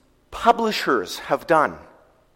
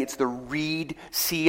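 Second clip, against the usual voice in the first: softer, slow, "Reed" drawn out; stress on Reed; 10 dB quieter, slower than usual. Shown as a demonstration. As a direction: lean on "Reed" as the correction. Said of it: "not actually the Red Sea".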